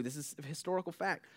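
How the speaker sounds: ordinary talk, nothing marked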